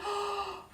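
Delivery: high shocked intake of breath; sharp gasp. Acted. Storyteller acting the brothers' shock, sharp gasp. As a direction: gasp in horror.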